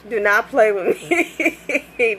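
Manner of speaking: slow, drawn out through a laugh; laughing warning. Pretend. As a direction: stretch it out, laughing at yourself.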